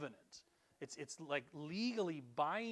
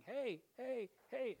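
mock wife calling, pitched higher; 8 semitones higher, slower than usual. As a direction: call the kids the way the wife does. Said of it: "my wife saying".

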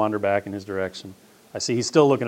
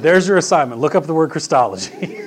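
laughing; 6 semitones higher, 6 dB louder than usual. Real, not acted. Retelling with amusement.

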